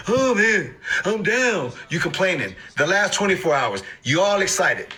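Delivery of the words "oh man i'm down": higher, whiny mock complaint; hammed-up disappointment; mock moping. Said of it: "You look at it over the last hour".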